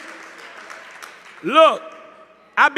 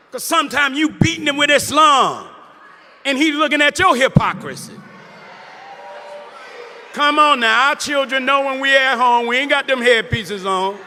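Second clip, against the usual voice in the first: fervent preacher's call to the crowd; preaching at full force. Acted. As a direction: preach it firmly, calling out to the room. Preaching to congregation.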